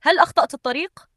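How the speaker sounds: high-pitched, amazed question; astonished. A narrator's reading. Woman at a huge gate, astonished. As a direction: ask in surprise. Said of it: "نظرت فاطمة بدهشة".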